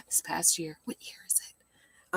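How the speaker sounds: breathy whispered self-correction; whispering aside. Real, not acted. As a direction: whisper it under your breath.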